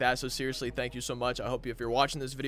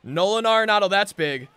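loud burst of real excitement; excited play-call; 4 semitones higher, 6 dB louder than usual. Real, not acted. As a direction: cheer the big hit as it happens. Big hit for his team.

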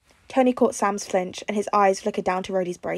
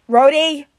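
loud, high call for help; urgent shout; 7 semitones higher, 10 dB louder than usual. A narrator's reading. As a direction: shout his name in alarm. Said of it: "Tony shouted".

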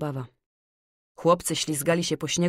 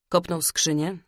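ordinary delivery, nothing marked

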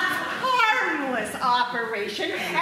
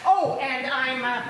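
ordinary delivery

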